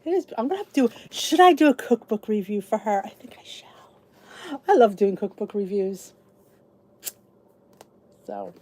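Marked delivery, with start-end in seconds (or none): 3.1-4.6 whispered resolve; hushed playful decision. Real, not acted. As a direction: breathe it out slowly.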